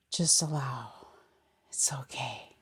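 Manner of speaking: soft, low, near-whispered comfort; hushed, falling reassurance. Real, not acted. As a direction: soothe gently, letting the voice drop. Soothing aside within meditation instructions.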